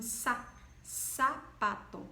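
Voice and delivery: slow sa, then sapato with a hissed s. Shown as a demonstration. Teacher isolating sa in sapato. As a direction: isolate sa before the whole word. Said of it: "o som dele mesmo".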